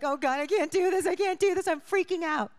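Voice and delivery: high, loud retold panic, close to tears; panicked crying. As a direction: cry out to God in rising panic. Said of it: "started crying again".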